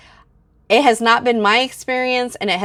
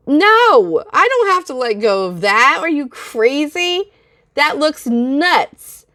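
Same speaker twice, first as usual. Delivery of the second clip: higher and louder, incredulous and fired up; indignant disbelief; 5 semitones higher, 3 dB louder than usual. Real, not acted. Answering a commenter about her gums, outraged disbelief. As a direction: push back hard, as if the idea is absurd.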